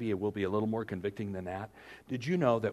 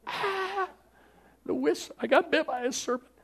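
high startled cry of pain; a hammed-up cry from a snake bite; mock yelp; 5 dB louder than usual. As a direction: yelp like you were just bitten. Bitten complainer cries out.

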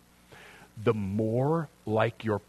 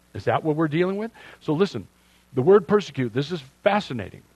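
ordinary delivery

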